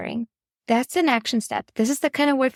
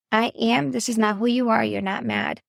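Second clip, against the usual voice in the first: ordinary talk, nothing marked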